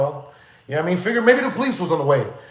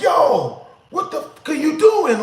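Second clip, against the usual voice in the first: retold yelling; 8 semitones higher than usual. Driver getting out of the car to yell at him, mock yelling. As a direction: imitate the driver yelling, voice pushed up. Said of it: "he starts yelling at me".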